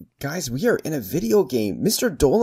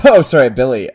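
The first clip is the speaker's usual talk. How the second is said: laughing; 10 dB louder than usual. Real, not acted. Startled laugh at Billy.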